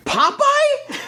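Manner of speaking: very high-pitched disbelieving squeak; incredulous. Real, not acted. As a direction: question it in stunned disbelief. Disbelief at co-host's example.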